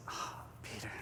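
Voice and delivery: barely voiced, very soft sigh; tender whisper. Jesus responds to Peter's confession, tender whisper. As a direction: breathe the name out gently.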